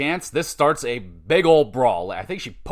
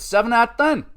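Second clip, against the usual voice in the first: higher, punchy verdict announcement; rating call; 8 semitones higher, faster than usual. Acted. Recapper announcing his match score, higher punchy call. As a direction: deliver the score like a show host.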